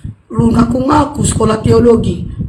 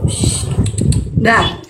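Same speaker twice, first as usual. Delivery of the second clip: ordinary talk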